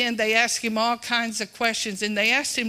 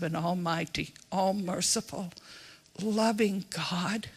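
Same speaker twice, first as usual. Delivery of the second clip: whispered; 6 dB quieter than usual. Real, not acted. Preacher to congregation on God's love, hushed whisper. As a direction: breathe the words out softly. Tender phrase in sermon.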